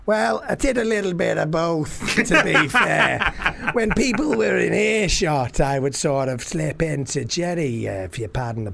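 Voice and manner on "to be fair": slow words broken up by real laughter; laughing. Real, not acted. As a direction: laugh your way through it. Laughing through his own remark.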